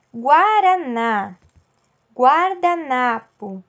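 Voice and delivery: Guaraná, guardanapo spelled out slowly with the U heard; slow sounded-out U. Shown as a demonstration. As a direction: stretch each syllable so the U in gua comes through. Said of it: "acompanhados da vogal A". (pronúncia lenta e silabada, com o U audível)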